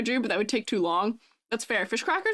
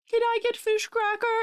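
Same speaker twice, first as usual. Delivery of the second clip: much higher, little pleading voice; put-on begging voice; 11 semitones higher than usual. Streamer mimicking a plea for fish cracker, high begging voice. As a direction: beg in a tiny high-pitched voice.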